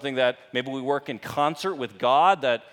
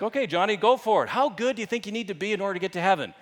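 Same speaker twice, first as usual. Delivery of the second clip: lightly acted eager teacher, higher and quick; 7 semitones higher, faster than usual. Substitute teacher calling on little Johnny in class, eager and high. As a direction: call on a little pupil brightly.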